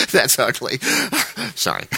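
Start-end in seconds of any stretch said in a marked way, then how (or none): 0.8-1.5 high, short laughing fit; real laugh. Real, not acted. Preacher after teasing the congregation, laughing. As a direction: crack up helplessly for a moment.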